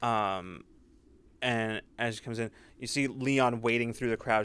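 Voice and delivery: usual speaking voice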